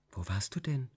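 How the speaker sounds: soft question from the dark; quiet question. A narrator's reading. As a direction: ask gently, barely above a breath. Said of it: "fragte ich leise aus dem Dunkel". (Tochter fragt die Mutter leise im Dunkeln)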